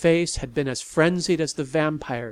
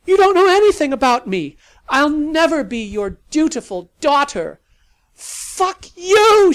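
high, loud desperate shout; desperate cry; 13 semitones higher, 13 dB louder than usual. Acted. Daughter screaming at her father before fleeing, desperate. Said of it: "she cried desperately".

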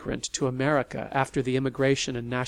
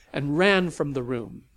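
ordinary delivery

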